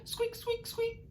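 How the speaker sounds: extremely high, soft squeaky squeaks; Artie squeaks. Shown as a demonstration. Teacher leads the class in Artie squeaks, very high squeaks. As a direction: squeak like a tiny mouse. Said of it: "our best Artie squeaks".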